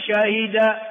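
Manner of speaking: شهد with kasra on the ha; original verb form. Shown as a demonstration. Teacher drilling four forms, first "shahida". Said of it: "أربع لغات".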